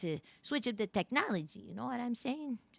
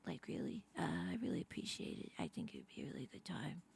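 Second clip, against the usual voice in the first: whispering; 7 semitones lower, 13 dB quieter than usual. Acted. Whispered plea in pitch letter.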